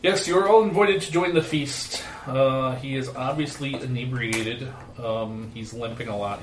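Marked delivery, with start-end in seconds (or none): 0.0-2.0 booming arrogant alien overlord. Acted. GM voices elf lord.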